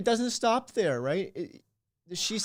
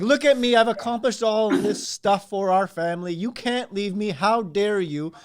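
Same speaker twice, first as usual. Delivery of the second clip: loud, puffed-up outrage, slowing on how dare you; hammed-up angry husband; mock indignant shout; 5 dB louder than usual. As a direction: bellow like an offended provider.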